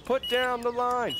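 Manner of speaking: high-pitched scramble; urgent, high-pitched exclaiming; flustered urgency. Real, not acted. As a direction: rush of panic at a sudden bite. Flustered when another line gets a bite.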